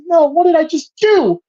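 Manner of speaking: as if you just saw what you did; stricken realization. Retold or imitated. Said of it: "looks and goes and goes".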